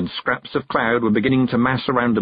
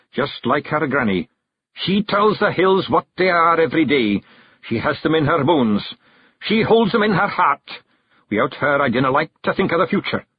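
higher, earnest Scottish lament; Scots clan chief, brooding; 7 semitones higher than usual. A narrator's reading. Rob broods aloud about the young hag, earnest. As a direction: muse earnestly in broad Scots.

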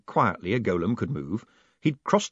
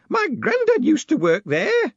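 high, slow and loud woman's voice; insistent woman; 6 dB louder, slower than usual. A narrator's reading. Woman telling the new postmaster about granddad, insistent. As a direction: proudly announce it, pitched way up. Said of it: "she repeated".

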